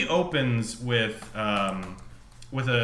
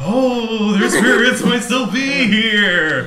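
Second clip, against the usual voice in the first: mock tour guide; 8 semitones higher, 9 dB louder, slower than usual. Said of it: "And they're like".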